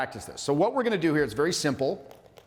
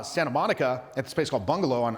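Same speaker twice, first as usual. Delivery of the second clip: ordinary talk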